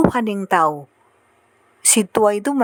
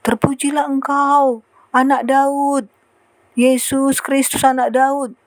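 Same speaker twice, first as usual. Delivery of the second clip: higher, drawn-out imitated call; crying out praise; 5 semitones higher, slower than usual. Crowd crying out to the Son of David, imitated drawn-out call. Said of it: "berseru-seru orang".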